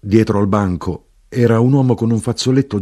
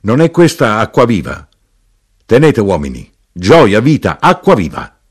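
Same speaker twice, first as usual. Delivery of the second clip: loud calls, building higher; innkeeper's hawking cry; 4 semitones higher, 6 dB louder than usual. A narrator's reading. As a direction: cry up the drink, building to a cheer. Innkeeper keeps crying out the drink.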